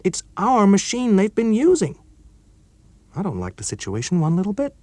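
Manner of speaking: nervous colleague fearing blame; worried young engineer; Chuck, worried. Acted.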